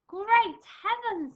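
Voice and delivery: high, loud, drawn-out gasp of surprise; surprised exclamation. Acted. Missus Armitage startled by the rain, surprised cry. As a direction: exclaim it as if caught by sudden rain.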